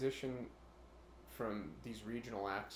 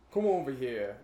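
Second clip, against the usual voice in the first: put-on Italian-American accent; 3 semitones higher, 10 dB louder than usual. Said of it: "Does that really sound Italian to you?".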